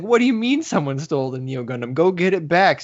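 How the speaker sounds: mock-upset commander, pitch rising on the order; a hint of an angry villain barking orders; upset Baz. Villain scolds his crew over the stolen Gundam, mock upset. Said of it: "So he's really upset".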